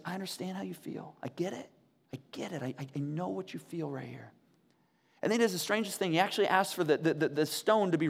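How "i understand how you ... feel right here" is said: softly spoken, understanding comfort; tender reassurance. Acted. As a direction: reassure them quietly, voice dropped. Voices Jesus comforting the grieving.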